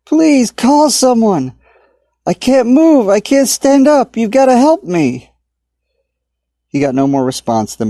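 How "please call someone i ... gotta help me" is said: high, loud, slow pleading shout; desperate shouting. A narrator's reading. Stranded man begging unseen crowd, desperate shout. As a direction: beg at the top of the voice. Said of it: "Tommy shouted".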